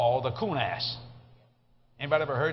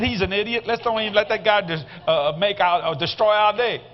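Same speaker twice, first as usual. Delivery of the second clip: loud retold advice; 4 semitones higher, 6 dB louder than usual. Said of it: "I said, I said".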